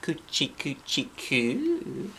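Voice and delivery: soft, playful coochie coo; playful tickle coo. Acted. Girl tickling Lucille, soft playful coochie coo. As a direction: tease her softly while tickling. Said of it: "real fun".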